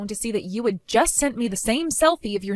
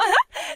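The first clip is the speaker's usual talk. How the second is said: high cackle of glee. Acted. Cracking up at the husband.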